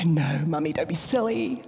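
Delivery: whispered giggly "No, Mummy"; child's reply. Retold or imitated. Daughter dismissing mum's silly question, half-whispered. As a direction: brush it off like an amused little girl. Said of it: "She'll go".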